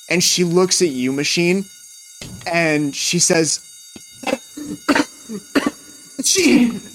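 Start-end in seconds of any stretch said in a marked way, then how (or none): 4.2-5.0 smoky cough. Acted. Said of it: "Grace is coughing through the smoke". 6.2-6.8 desperate call. Acted. Grace calls out through smoke.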